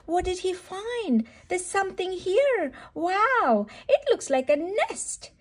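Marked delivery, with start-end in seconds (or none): none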